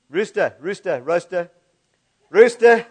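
rooster repeated to get it right; working "rooster" out aloud; practising the word. Shown as a demonstration. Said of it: "It's when you have a dyslexic preacher".

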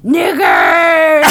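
high drawn-out shout; yelling. Retold or imitated. Retelling a disruptive man's outburst to friends, yelling. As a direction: yell it like the man down there. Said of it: "what the dude was yelling down there".

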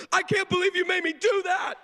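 very high mock shriek; hammed-up squealed complaint; indignant outburst. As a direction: imitate an outraged kid yelling at dad. Daughter's outburst once cameras go off.

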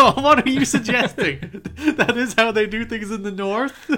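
high and loud, laughing through the words; mock-accuse him while cracking up; gleeful laughing accusation; laughing tease. Real, not acted. Teasing friend about northern police.

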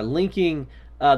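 usual speaking voice

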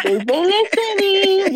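high, slow, laughing delivery; knowing laugh. Retold or imitated. Guest telling how listeners change their lives, amused and laughing. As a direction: savor it with a laugh.

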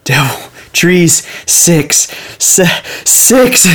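high, strained eerie voice, word by word; spooky sleep-talker blurting lone words; creepy sleep talk. Retold or imitated. Faking sleep talk to freak out Avery, creepy high voice. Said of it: "saying all this creepy shit".

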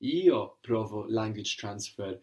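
Io stressed, slow and clear, ee-oh; emphatic io. Shown as a demonstration. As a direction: lean on Io as the emphatic I. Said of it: "if you wanted to be emphatic".

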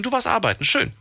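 high mock-cheerful "schön"; sarcastic. Real, not acted. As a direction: tease her with fake praise. Teasing about working weekend. (spöttisch ironisch)

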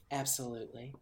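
very soft, understated reply; mock eerie calm. Disfigured sister answers about her interview, hushed mock calm. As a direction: say it hushed, playing the creepy survivor. Acting sinister survivor's answer.

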